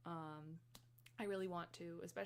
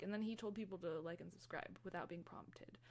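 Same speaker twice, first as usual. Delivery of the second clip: ordinary delivery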